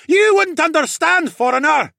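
very high, loud jeer; scornful heckler shout. A narrator's reading. Crowd member to a foreigner, scornful shouting. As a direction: shout it down at an outsider.

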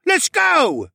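high, loud rallying shout; yelling. A narrator's reading. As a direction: yell to rouse the crowd. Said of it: "a man in the crowd yelled".

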